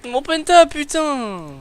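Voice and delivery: outraged yell, pitch jumps high; frustrated shout. Real, not acted. Pentakill just missed.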